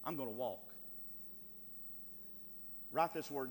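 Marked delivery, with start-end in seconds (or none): 0.0-0.7 low, unhurried calm; calm refusal. Retold or imitated. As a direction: settle the voice into composure. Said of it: "But the psalmist said".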